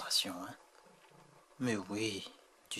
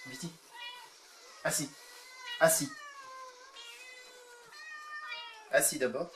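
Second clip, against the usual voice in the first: higher sing-song commands to pets; talking to a cat; 3 dB quieter than usual. Real, not acted. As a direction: coax and praise the cat. Training cats with treats.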